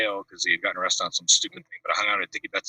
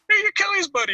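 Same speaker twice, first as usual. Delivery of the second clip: much higher, mock rock-star greeting; imitating a rock singer; 4 dB louder than usual. Rock star walks into the house, greets him in a high mock voice. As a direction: pitch the greeting way up like a famous singer.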